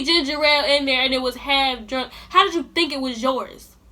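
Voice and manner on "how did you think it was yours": ordinary delivery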